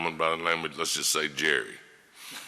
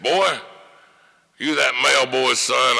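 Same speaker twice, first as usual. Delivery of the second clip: higher, louder mock old-lawyer bark; old lawyer's gruff call; 7 semitones higher, 6 dB louder than usual. Old lawyer asking the young man in court, imitated with a raised bark. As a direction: toss it over like a crusty old attorney.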